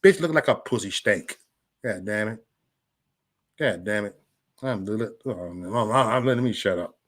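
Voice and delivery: ordinary talk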